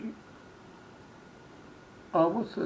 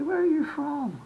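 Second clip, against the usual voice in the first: higher, louder imitation of a curious local; curious locals' question; 12 semitones higher, 6 dB louder, slower than usual. Hollywood locals ask the visitor where he's from, high-pitched curiosity. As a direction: ask it high, playing an interested stranger. Voices locals greeting him.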